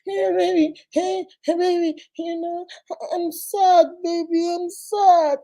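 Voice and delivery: put-on high, pinched, babyish voice, as if squeezed at the neck; mock baby talk, high and squeezed, sad-sounding; strangled side-chick whine. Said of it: "like somebody is holding them on the neck".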